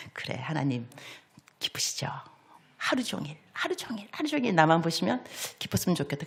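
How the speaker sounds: soft, near-whispered private prayer; hushed to himself. Retold or imitated. Preacher savoring his own written prayer in a hush. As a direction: whisper it as if moved alone. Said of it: "제가 혼자 은혜 받아요".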